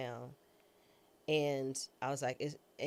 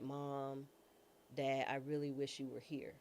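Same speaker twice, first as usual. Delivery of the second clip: quiet plea; 3 semitones lower, 8 dB quieter than usual. Real, not acted. Alone in ambulance, calling parents.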